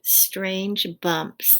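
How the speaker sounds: ordinary delivery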